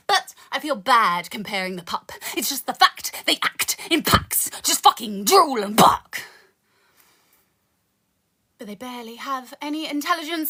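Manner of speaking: rhythmic poetry recital with dark punchlines; spoken word poem. Acted. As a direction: perform it like a slam poem.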